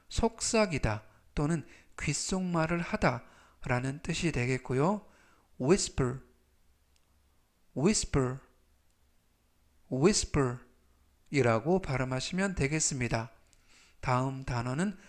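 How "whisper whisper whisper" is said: section said slowly and clearly; slow model pronunciation. Shown as a demonstration. Teacher reading vocabulary aloud for review, slow and clear. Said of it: "제가 천천히 세 번씩 읽어드리고요".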